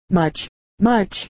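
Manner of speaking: a touch of a mock sob, understated; mildly tearful, barely breaking; sobbing. A narrator's reading. Mother agrees through tears, with a hint of sobbing. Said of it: "sobbed the mother".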